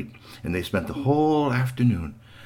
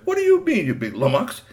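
put-on challenging boy; 9 semitones higher than usual. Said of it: "looked at Bigfoot and said".

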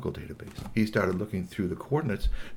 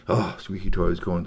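usual speaking voice

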